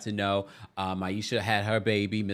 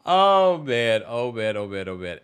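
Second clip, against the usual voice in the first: laughing sigh; 2 semitones lower, 6 dB louder, slower than usual. Real, not acted. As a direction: laugh fading into sighs. Laughing sighs fading away.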